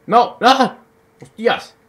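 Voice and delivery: sudden high yell of shock; startled shout. Real, not acted. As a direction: cry out as the enemy jumps you. Ambushed in the game. (grito de sorpresa al ser emboscado)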